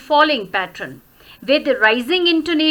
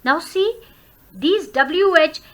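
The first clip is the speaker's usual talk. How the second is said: ordinary talk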